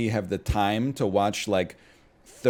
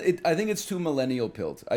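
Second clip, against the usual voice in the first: ordinary talk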